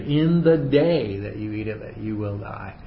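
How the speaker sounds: slow, deliberate weight on day; stress on DAY. Shown as a demonstration. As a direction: spell out IN THE DAY word by word. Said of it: "it's emphatic".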